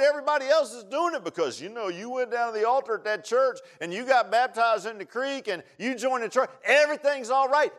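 high, loud pulpit cry; impassioned warning from the pulpit; full-force preaching. Real, not acted. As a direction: drive it home in a rising, relentless preacher's chant.

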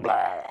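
loud mock retch; comic drawn-out vomiting noise; imitated throwing up. Preacher imitating brothers with weak stomachs, mock throwing up. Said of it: "if one of them threw up".

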